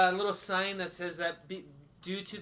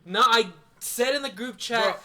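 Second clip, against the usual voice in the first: indignant; 5 semitones higher, 9 dB louder, slower than usual. Real, not acted. Arguing about the forgotten beer.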